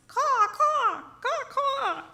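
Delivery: high, loud crow cawing. Acted. Teacher voicing the crow refusing Harold, high cawing. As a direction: caw like a cheeky crow. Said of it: "But the crow only replies".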